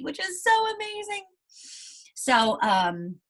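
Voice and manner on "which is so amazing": excited gush. Real, not acted. Teacher marvels at Mo Willems' output.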